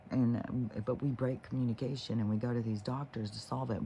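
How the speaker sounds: ordinary talk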